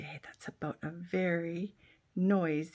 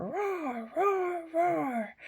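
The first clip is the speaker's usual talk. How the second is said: playful roar; 10 semitones higher, much slower than usual. Acted. Bear roars a song.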